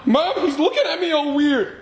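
put-on high whiny kid voice with laugh; tattle, half laughing; whiny tattling little sibling. Kids tattle to mom.

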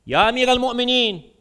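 loud, high formal call to a sovereign; guard addressing the ruler. Acted. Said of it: "The guards say".

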